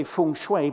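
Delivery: "feng shui" drawn out as FUNG-shway; proper "feng shui". Shown as a demonstration. Comedian correcting an American guest's feng shui, said fung-shway. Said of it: "we all should say".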